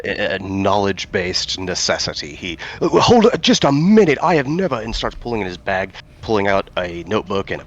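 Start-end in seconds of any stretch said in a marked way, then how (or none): 2.8-4.8 high, eager scholar voice, talking louder; a thrilled researcher stopping the party short; excited scholar Owen. Acted. Player voicing Owen, a thrilled scholar, high and eager.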